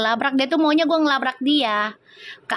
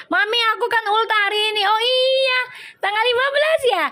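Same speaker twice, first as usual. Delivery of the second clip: excited high reply; 11 semitones higher than usual. Real, not acted. Reads a viewer's birthday comment.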